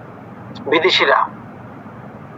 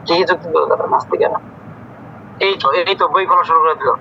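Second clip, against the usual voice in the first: ordinary talk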